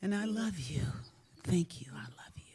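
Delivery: whispered, fading to almost nothing; soft whispered affection, trailing away; tender self-love. Shown as a demonstration. Holding herself and telling her body she loves it, whispering tenderly.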